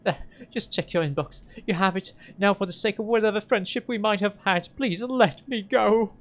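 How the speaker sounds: tearful pleading. Acted. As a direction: beg to be let go through tears.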